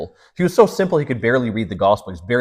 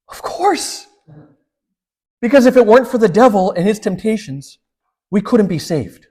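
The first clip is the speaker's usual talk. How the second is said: cheerful certainty; 10 semitones higher, 4 dB louder than usual. Retold or imitated. Saint's punchline answer to bishop.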